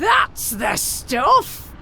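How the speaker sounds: slow, booming cheer; big drawn-out shout of triumph; triumphant shout. Acted. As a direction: bellow it out in victory. Flames put out.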